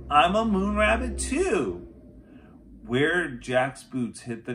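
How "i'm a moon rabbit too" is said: high, louder playful boast; gleeful call. A narrator's reading. Jack bounding after Annie, playfully calling out. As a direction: crow it like a happy kid. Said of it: "he called to Annie".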